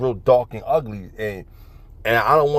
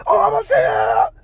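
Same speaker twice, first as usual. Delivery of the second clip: mock upset mom; 4 dB louder, slower than usual. Mom's reply, voiced.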